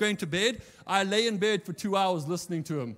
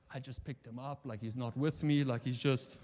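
embarrassed aside; 7 semitones lower, 5 dB quieter than usual. Retold or imitated.

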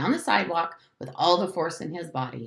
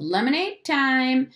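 yelled summons; 6 semitones higher, 4 dB louder than usual. A narrator's reading. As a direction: call everyone in from the doorway. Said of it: "appeared in the front door and yelled".

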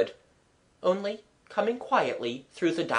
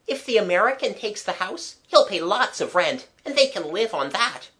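lightly voiced boy, higher-pitched; a hint of a practical young boy; Jimmy, practical boy; 6 semitones higher than usual. A narrator's reading. Boy working out how the couple will live, practical.

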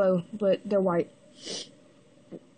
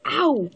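ow of pain; 3 semitones higher, 4 dB louder than usual. Real, not acted. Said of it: "It hurts".

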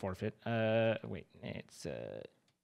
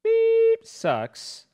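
witch voice; 4 semitones lower, much slower than usual. Pretend. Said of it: "Sounded like a witch there".

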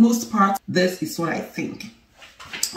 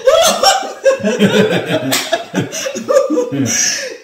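shrieking laugh, high and loud; loud high burst of laughter; 6 dB louder than usual. Real, not acted. Wife cracking up at her husband's joke, loud high laughter.

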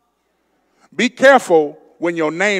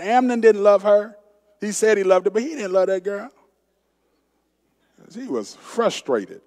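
ordinary talk, nothing marked

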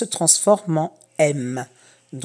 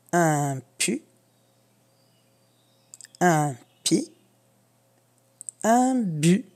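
slow syllable reading; 2 semitones lower, slower than usual. Shown as a demonstration. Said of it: "tu suis les flèches".

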